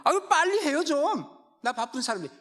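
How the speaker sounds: high, loud mock-impatient waiter. Pastor mimicking a rude waiter, hammed-up impatience. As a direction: hurry the customer like a rude waiter.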